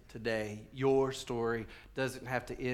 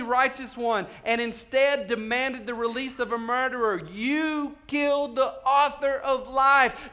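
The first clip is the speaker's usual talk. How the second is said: very high, loud, slow accusation; fiery preacher acting an apostle; Peter accusing the crowd; 11 semitones higher, 9 dB louder, slower than usual. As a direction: hurl the charge at the whole crowd. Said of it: "This is Peter looking at these people going".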